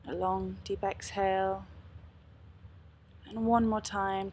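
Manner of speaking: calm, unhurried meditation guide; guided meditation. Acted. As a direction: lead them gently into stillness.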